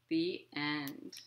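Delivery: ordinary delivery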